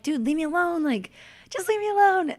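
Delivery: high, exasperated plea, rising to the end; fed up. Retold or imitated. Her telling the taunting Marines off, exasperated. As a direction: beg them to just go away.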